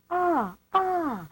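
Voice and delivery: two high, open "ah"s gliding down; high falling "ah, ah"; imitated singing exercise. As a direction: mimic a pupil's vocal exercise. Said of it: "because she used to go".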